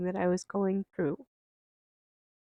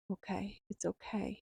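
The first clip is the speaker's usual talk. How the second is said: softly soothing oneself; quiet self-reassurance; 7 dB quieter than usual. Retold or imitated. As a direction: settle into it, hushed and accepting. Soothing self-talk after a sigh.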